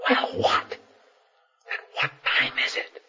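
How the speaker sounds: slow, pleading whisper. Retold or imitated. Pressing a friend in the pew, whispering. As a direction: whisper it, drawn out and impatient.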